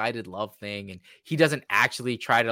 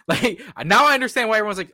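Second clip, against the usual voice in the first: higher, louder and fast; exasperated outburst; 9 semitones higher, 8 dB louder, faster than usual. Real, not acted. Host finally understanding the backlash, loud fast outburst. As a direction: blurt it out in disbelief.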